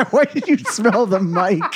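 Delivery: slow, loud laughing question. Real, not acted. Laughing at mic mishap.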